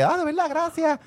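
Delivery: high, soft mock-grateful voice; agradecimiento fingido. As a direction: imitate a girl sweetly saying thanks. Imita a la muchacha agradecida. (vocecita aguda de agradecida)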